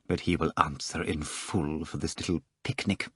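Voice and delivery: low, very soft grim promise; quiet reply. A narrator's reading. Rimsky vowing the absentee will pay, quietly. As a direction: keep the threat hushed and low. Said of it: "Rimsky replied quietly".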